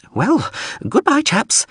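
very high little drone voice; hasty drone farewell. Acted. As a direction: bid farewell in a high, small voice. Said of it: "the drone said hurriedly".

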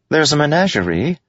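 high, eager suggestion. A narrator's reading. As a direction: pitch it excitedly.

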